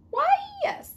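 high, showy dragon voice; proud dragon. Acted. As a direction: puff up with pride. Boastful dragon agrees.

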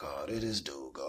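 very low, soft aside; muttered dread. Retold or imitated. To himself as the stranger looks round back, muttered dread. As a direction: grumble it privately, low and weary.

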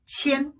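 "첸" held high and level; first tone. Shown as a demonstration. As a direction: keep "첸" on one high note. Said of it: "일 성이 왔죠".

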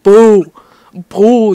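loud, drawn-out crowd boo; jeering boo. Acted. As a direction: boo him loudly. Jeering at Greg.

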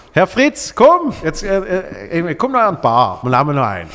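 high, loud, pushy invitation; pushy shout. Retold or imitated. Pushy comedian urging shy colleague to the bar, loud shout. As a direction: call him over to the bar, pushing hard. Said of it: "ich gehe jetzt einfach quasi wie aggressiv".

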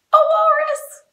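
gasp, then squeal it out; pretend surprise. Teacher revealing who's at the door, astonished mock surprise. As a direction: gasp and very high, loud reveal.

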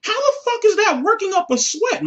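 ordinary talk

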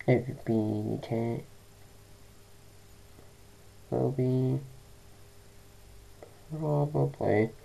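very soft, low and subdued; hushed, low-pitched private update; talking quietly. Real, not acted. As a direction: keep the volume right down. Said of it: "Sorry, I'm talking quietly".

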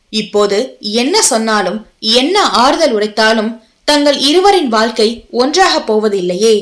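ordinary delivery, nothing marked

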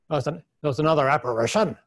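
quick, lilting Irish brogue, lightly put on; light Irish accent imitation. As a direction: mimic the Irish scholar's accent, briskly. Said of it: "he did in this Irish accent".

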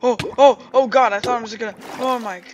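loud, high frightened yelps; startled panic. Real, not acted. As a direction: jump out of your skin. Jump scare in ocean.

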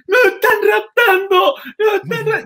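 very high, loud fake scream; mock scream. Drunk passenger screaming out the car window, very high and loud. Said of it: "empecé a gritar".

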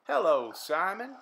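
a cheerful farmer answering. Acted. The farmer greeting Simon in his own voice. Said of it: "replied the farmer".

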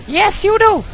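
put-on the girl persona overruling him; squeaky girl voice answering back; English girl voice. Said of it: "girl persona answers back".

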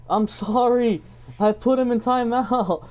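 real oops, scrambling to apologise; flustered apology. Real, not acted. Streamer realises he timed out a viewer by mistake, flustered.

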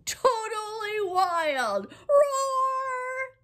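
high, loud mock roar; hammed-up animal roar; playful roar. Animals go wild.

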